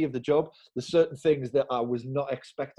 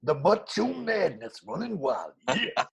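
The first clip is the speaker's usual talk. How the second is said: slow, gravelly-free wrestler promo drawl, pitched up; wrestling promo; 4 semitones higher, slower than usual. Pretend. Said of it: "I'll cut a promo now".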